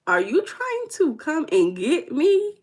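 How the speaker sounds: high, slow, drawn-out disbelief; playful incredulous question. Real, not acted. Streamer to viewer asking her city, playfully incredulous. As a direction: stretch it out high as a teasing challenge.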